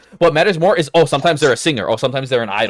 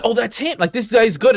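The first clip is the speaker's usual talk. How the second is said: excited recognition; 10 semitones higher, 4 dB louder than usual. Retold or imitated. Excited reaction to hearing anime voice.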